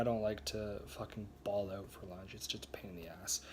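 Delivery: quick unvoiced whisper; whispered aside. Real, not acted. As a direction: throw it away under your breath. Aside muttered under his breath.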